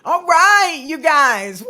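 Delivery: loud hype-up call; excited. Real, not acted. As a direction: fire up the audience. Hyping segment start.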